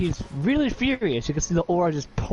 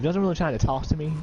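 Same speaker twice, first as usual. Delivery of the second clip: ordinary delivery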